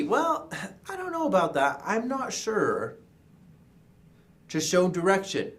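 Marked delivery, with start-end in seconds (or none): 0.0-3.0 high, hesitant disagreement, drawing out the last words; doubtful disagreement. Shown as a demonstration. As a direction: push back doubtfully in a raised pitch. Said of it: "To disagree".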